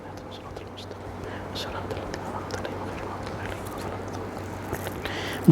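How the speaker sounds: soft murmured prayer, mostly breath; hushed private recitation of Fatiha; whispered Quran recitation. Acted. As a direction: breathe the surahs quietly to yourself.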